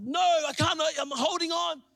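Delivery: high mock-panicked refusal; acted stubborn cry; imitated yelling dad. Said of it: "he's yelling back at me".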